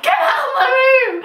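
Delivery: very high, slow screech; mock hysterical order. Throwing someone out of the bedroom, high hysterical screech. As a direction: wail it like a furious teenager.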